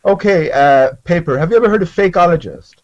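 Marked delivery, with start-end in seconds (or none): none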